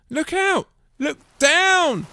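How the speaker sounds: high, loud warning; urgent alarm call; yelling. A narrator's reading. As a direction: yell it to stop them. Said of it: "who yelled".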